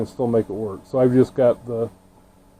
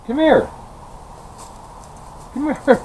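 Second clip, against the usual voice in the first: calling the dog; 12 semitones higher, 7 dB quieter than usual. Real, not acted.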